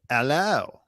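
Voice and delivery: imitated British hello; lightly mimicked British greeting; British accent. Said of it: "Jamila has a British accent".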